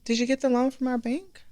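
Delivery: retold customer question. Bank owner couple asking the restaurant owner about her loan, lightly voiced. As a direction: ask it like a curious bank owner, only half acted. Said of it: "They said".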